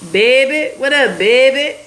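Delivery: imitated New Orleans accent. Streamer mimics New Orleans locals to her viewers, imitated New Orleans accent. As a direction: put on a New Orleans accent, lifting the line endings. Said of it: "That's exactly how y'all say it".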